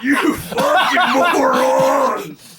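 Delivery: very slow drawn-out troll yell. Acted. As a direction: stretch every word in a raging troll howl. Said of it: "Your troll voice is fucking perfect".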